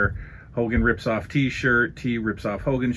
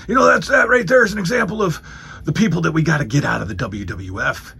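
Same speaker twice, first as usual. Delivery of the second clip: fast, loud, much higher hyped shout; Hulk Hogan impression; 6 semitones higher, 4 dB louder, faster than usual. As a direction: yell it like a pumped-up wrestling promo. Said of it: "He just says".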